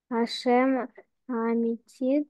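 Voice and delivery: ordinary delivery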